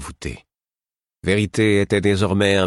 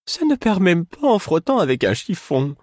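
higher, whining fool; the fool complaining, plaintive; 13 semitones higher, 3 dB louder than usual. A narrator's reading. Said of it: "dit-il d'un air plaintif".

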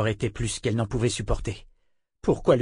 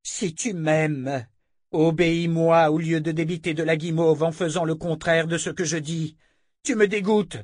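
angry; 6 semitones higher than usual. A narrator's reading. Confessor berating Richard in rising, high angry scorn. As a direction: lash him with scorn. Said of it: "leva un poing rageur".

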